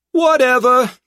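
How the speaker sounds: high, loud brash thug; relieved brash hoodlum voice. A narrator's reading. Tripp character voice.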